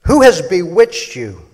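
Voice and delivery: loud, high preaching read; fiery preacher's scripture reading. Shown as a demonstration. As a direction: proclaim it like a pulpit preacher. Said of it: "gonna read it just kind of like a preacher would".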